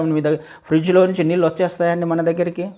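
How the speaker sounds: usual speaking voice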